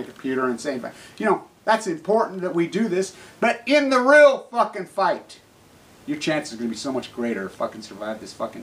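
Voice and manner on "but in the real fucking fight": slow, high and loud, leaning on every word; fierce shout. Real, not acted. Speaker to viewers, urging them into the fight, shouting. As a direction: hammer it home. Ranting about fighting the disease.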